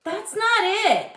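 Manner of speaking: high, loud retort over the shoulder; yelling back. A narrator's reading. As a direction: yell it back across the playground. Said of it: "I yelled back".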